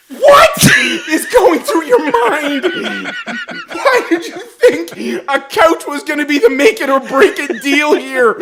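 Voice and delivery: high, explosive "what", then high-pitched incredulity; retold outburst of baffled exasperation; exasperated disbelief. Son confronting dad about the couch, exasperated.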